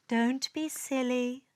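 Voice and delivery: ordinary delivery, nothing marked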